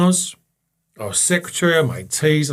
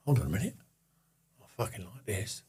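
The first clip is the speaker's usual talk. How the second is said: mutter; 6 semitones lower, 15 dB quieter, faster than usual. Retold or imitated. Muttered realisation in meeting.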